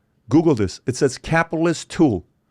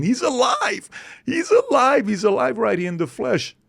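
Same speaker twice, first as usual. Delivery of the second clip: put-on high, excited cry of kids; mock kids crying out; 12 semitones higher, slower than usual. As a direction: shout it like thrilled kids. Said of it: "crying, saying".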